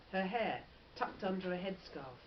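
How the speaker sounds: ordinary delivery, nothing marked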